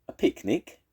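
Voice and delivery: high, teasing question; cheeky. Acted. Weasel mocking Badger's caution, cheeky. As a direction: tease them with a cheeky jab. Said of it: "said Weasel cheekily".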